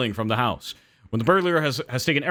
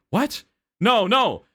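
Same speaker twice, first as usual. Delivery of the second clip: much higher startled yelp; alarmed protest; 10 semitones higher, 4 dB louder, much slower than usual. Acted. Husband cuts off the admiring burglar in alarm. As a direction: jump in alarmed.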